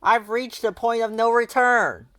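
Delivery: put-on high, loud boyish cry; Pigpen yelling. A narrator's reading. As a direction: shout it out in a high boy voice. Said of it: "he yelled".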